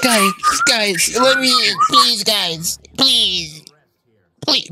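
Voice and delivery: whiny pleading. Real, not acted. Locked out, begging teammates.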